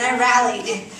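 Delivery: ordinary delivery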